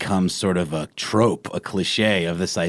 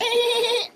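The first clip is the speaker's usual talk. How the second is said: very high squeaky dolphin squeal; dolphin sounds. Pretend. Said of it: "It's hard to get high enough, you know, the dolphins".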